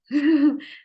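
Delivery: short, high laugh; amused laugh. Real, not acted. Teacher amused that dance turned out to represent nothing, short laugh. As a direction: burst briefly into a high chuckle.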